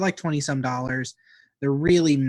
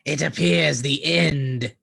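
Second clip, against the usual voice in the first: ominous prophecy; much slower than usual. Acted.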